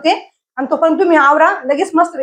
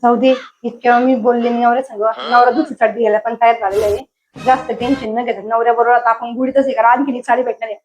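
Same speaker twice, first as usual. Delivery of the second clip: lower, confiding aside; aside to audience; 5 semitones lower than usual. Acted. Wife telling the audience how to get a new sari, lower confiding aside. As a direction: turn to the audience and let them in on it.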